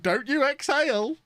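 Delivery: high, drawn-out mock yell; imitated yell. Streamer reporting what she yelled, high stretched mock yell. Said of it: "she just yelled".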